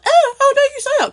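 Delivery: high, loud whiny mock outrage; mocking imitation. Mocking a hater who cries over her comments, high whiny outrage. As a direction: squeal it like an offended hater. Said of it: "you're gonna be like".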